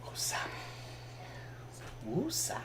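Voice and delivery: breathy whispered calming mantra; whispering. Real, not acted. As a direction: whisper it to calm yourself down. Calming herself after laughing fit.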